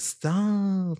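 high, drawn-out whine of objection; stretched, high-pitched complaint; mock protest. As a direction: imitate a friend pleading to be left alone. Annoyed friend objects to mispronounced name.

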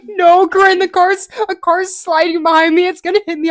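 high, loud panicked cry; re-live the terror, begging her friend; panicked pleading. Retold or imitated. Panicked retelling of near crash.

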